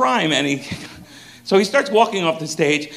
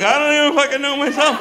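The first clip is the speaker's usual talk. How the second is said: laughing bafflement; 9 semitones higher, faster than usual. Retold or imitated. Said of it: "And I'm like".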